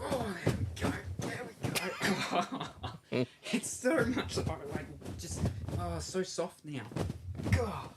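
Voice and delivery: excited. Real, not acted. Satisfied stuffing finished.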